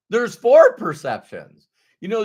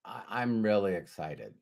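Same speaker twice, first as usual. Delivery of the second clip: unexcited delivery; 9 semitones lower, 11 dB quieter than usual. Shown as a demonstration. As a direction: mumble excitement with none in the voice. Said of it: "So you can say".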